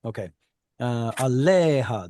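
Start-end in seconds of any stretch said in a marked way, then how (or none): 1.1-2.1 Aleha slowly, the middle LE drawn out and stressed; stress on penultima. Shown as a demonstration. Teacher returns to the example, Aleha stressed on the penultima. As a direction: lengthen the "le" of Aleha, then drop off. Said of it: "that should be the".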